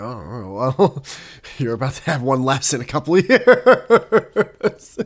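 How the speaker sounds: slow, laughing mock warning; laughing mock threat. Teasing a friend about dropping friends, slow laughing threat. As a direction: drag it out through a laugh. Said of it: "I'm like".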